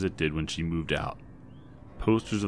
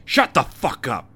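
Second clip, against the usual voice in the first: loud, spitting fury, pitched up; spat out; 6 semitones higher, 10 dB louder than usual. A narrator's reading. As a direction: spit it at the useless husband. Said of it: "Debbie spat at her useless husband".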